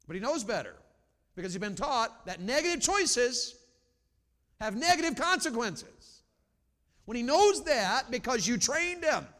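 high, loud preacher's cry; ringing, emphatic sermon delivery; full-force preaching. Real, not acted. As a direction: preach it out to the whole room.

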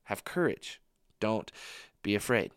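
soft, slowed hey I'm here; whisper-close comfort in the storm; gentle Jesus voice. Pretend. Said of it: "hear the voice of Jesus say".

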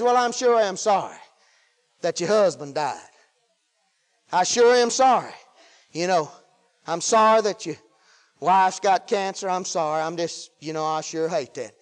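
low, soft, sorry condolence; pitying. Acted. Pity consoling the bereaved and the sick, low and sorry. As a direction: sink down into helpless sympathy. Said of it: "See, pity says".

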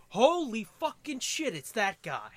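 loud high surprise; surprised. Retold or imitated. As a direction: blurt out the sudden recognition. Retold surprise.

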